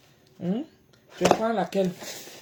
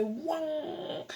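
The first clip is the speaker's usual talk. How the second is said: slow, drawn-out, higher "ouang" noise; imitated ear sound; 10 semitones higher, 4 dB quieter than usual. Shown as a demonstration. Describing her ear pain to viewers, a slow imitated ring. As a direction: stretch the noise out like a ringing in the ear. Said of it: "Ça rentre ici".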